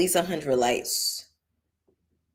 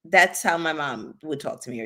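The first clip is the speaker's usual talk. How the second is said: normal talking voice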